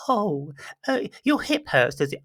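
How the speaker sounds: high, syrupy fake-caring voice; mock sympathetic carer. Mocking a carer fussing over a hip patient, high cooing voice. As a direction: coo it like an over-sweet nurse. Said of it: "I've never had anyone go".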